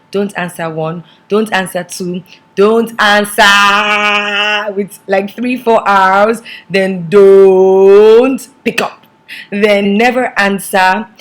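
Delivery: drawn-out listing. Pretend. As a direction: drag out each name for effect. Drawn-out listing of contact names.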